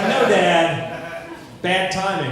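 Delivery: a hint of a fed-up teen; daughter voice. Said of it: "She's like".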